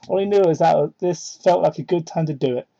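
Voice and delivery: ordinary talk, nothing marked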